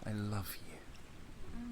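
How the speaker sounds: very slow, breathless whisper; whispered I love you. Acted. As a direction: breathe the words out, barely there.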